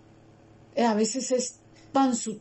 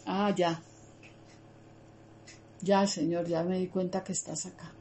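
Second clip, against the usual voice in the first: soft relieved murmur; 3 semitones lower, 7 dB quieter than usual. Retold or imitated. Relieved realization addressed to God.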